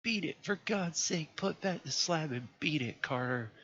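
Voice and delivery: high desperate cry; pleading at the top of his voice; panicked plea to run; piteous cry. A narrator's reading. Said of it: "a piteous cry from Warren".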